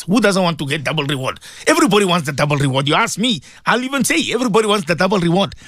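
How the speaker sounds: usual speaking voice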